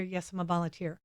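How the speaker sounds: a hint of the shy volunteer answering; soft modest reply. Voicing Hilda answering.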